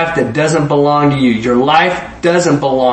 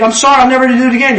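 put-on fast, high, louder kid's apology; child apologising; 9 semitones higher, 3 dB louder, much faster than usual. Child hurriedly apologises to parents, fast high pleading. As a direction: rattle off the apology like a caught kid.

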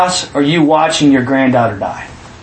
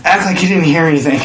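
ordinary talk, nothing marked

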